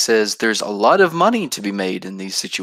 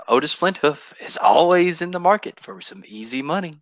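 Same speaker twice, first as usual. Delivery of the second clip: lightly hammed-up scheming villain; mustache twirling villain; 7 semitones higher than usual. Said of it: "start twirling his mustache and be like".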